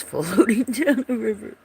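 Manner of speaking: usual speaking voice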